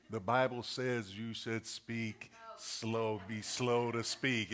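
put-on slow, deliberate childlike recital; mock slow-motion speech; slow kid voice. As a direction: recite the verse slowly like a kid. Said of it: "they come out like that".

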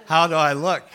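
loud, laughing mock-flirty question; playful tease. Calling out to his wife while riding past, loud laughing tease.

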